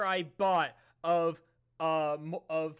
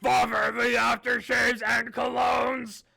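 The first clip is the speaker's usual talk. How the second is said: loud, higher, melodramatic wail; hammed-up dramatic reading; 4 semitones higher, 6 dB louder than usual. Narrator reads the note with hammed-up mock grief. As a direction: cry out for your father in mock anguish. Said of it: "reading this in my dad's voice".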